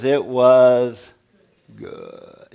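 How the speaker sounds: a Southern preacher's slow, sunk "good"; drawn-out southern good. Shown as a demonstration. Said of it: "You gotta say it like that".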